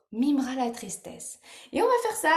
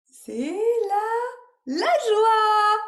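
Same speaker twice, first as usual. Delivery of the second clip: high, loud, stretched cheer; hammed-up delight for children; joyful reveal; 9 semitones higher, 7 dB louder, much slower than usual. Shown as a demonstration. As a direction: build suspense, then burst out gleefully. Reveals joy, acting it out.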